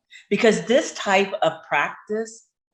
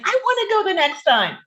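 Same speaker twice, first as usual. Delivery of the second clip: eager coworker; 5 dB louder than usual. Retold or imitated. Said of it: "She's like".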